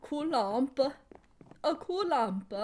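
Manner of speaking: playful put-on Swedish voice; half-hearted Swedish accent; mock Swedish accent. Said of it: "makes me think it's, like, Swedish".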